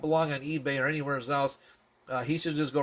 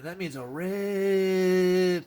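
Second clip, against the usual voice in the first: "ori-" held very long, higher; sounding it out; 4 semitones higher, much slower than usual. Acted. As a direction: draw out "ori-" as if teaching a child to read. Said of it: "trying our best to read this to you".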